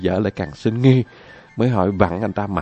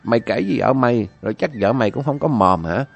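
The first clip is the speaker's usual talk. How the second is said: master's scolding; 6 semitones higher than usual. Acted. Master scolds servant over the leaked secret, mild mock anger. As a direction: chide the servant. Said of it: "rồi mắng rằng".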